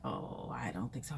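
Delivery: low, hushed whisper; soft disagreement. Retold or imitated. The stranger gently disagrees, whispered and low.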